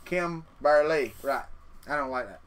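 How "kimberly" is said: "Kimberly" drawn out slowly, syllable by syllable; mock text-to-speech "Kimberly", slow and segmented; imitating synthetic voice. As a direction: stretch "Kimberly" like a stilted computer voice. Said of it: "That, that's exactly right".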